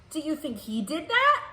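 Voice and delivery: ordinary talk, nothing marked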